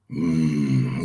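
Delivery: deep, imitated groaning "mm"; low wordless rumble of a looming butler; Lurch. Veteran wrestler reacts to rookie's first match, imitated low groan. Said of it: "like Lurch".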